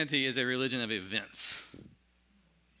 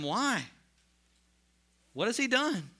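rising, higher pleading question; Pilate pleading; 10 semitones higher, 4 dB louder than usual. Acted. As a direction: plead with the crowd, pitch climbing. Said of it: "Pilate said to them".

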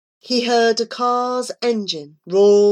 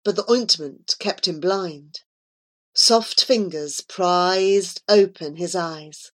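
usual speaking voice

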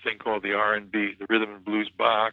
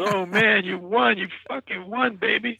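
excited friend; 9 semitones higher than usual. Retold or imitated. Friend following him to the stage after his win, excited. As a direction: imitate a thrilled friend in a raised pitch.

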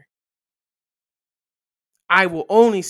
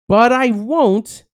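loud, slow and drawn out; emphatic; 6 semitones higher, 9 dB louder, much slower than usual. Acted. Preacher reading Jesus' restraint, loud and slow. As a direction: land each word heavy and slow.

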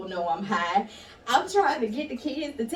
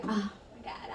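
soft whispered relief; relieved whisper; 10 dB quieter, slower than usual. Retold or imitated. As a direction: breathe it out quietly. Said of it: "In my mind, I'm like".

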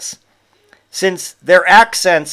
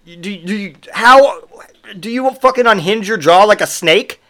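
ordinary talk, nothing marked

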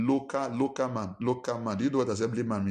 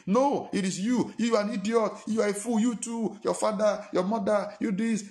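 mock insult-trading; 9 semitones higher than usual. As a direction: hurl the insults back, high and hot. Acted quarrel trading insults.

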